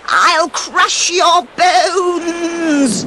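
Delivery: a wicked crone shrieking threats down from the ledge; witch screech. Acted. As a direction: screech it out like a furious old witch. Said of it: "she screeched at the dwarfs".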